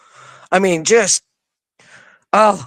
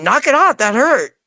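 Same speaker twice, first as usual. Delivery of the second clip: annoyed protest; 7 semitones higher than usual. Retold or imitated. Scolding the ram's head.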